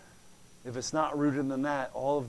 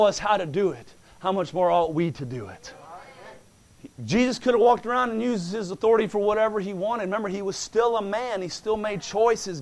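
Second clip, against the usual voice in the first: loud, rising preacher's cadence, punching key words; full-force preaching; 7 semitones higher, 9 dB louder than usual. Acted. Preacher exhorting the church about the bride, full force. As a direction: preach it to the congregation.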